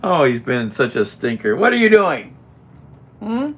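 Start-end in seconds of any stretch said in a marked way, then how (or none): 1.6-3.5 loud, much higher mock scold. Real, not acted. Scolding the cat at the table, loud and high.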